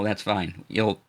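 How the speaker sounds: ordinary talk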